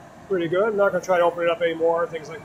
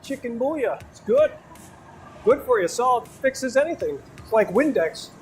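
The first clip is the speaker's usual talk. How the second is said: put-on Wisconsin accent; 5 semitones higher than usual. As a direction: play the cheese-and-kraut Wisconsin local. Hamming up Wisconsin stereotypes.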